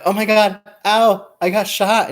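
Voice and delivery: high, loud panicked retelling of being shot; panicked cry. Retold or imitated. Girlfriend waking in pain, crying out to her boyfriend, high and loud. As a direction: replay the panic of waking from a nightmare. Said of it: "I was like".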